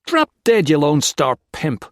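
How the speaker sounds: high, loud spat insult. A narrator's reading. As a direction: hurl the insult in a rage. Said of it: "Max spat at Tex".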